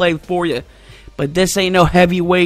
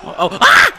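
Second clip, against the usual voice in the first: high yelps building to a loud cry; alarmed cries; 12 semitones higher, 5 dB louder than usual. Real, not acted. Gamer getting hit in the match, alarmed yelps. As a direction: yelp as if taking the blow.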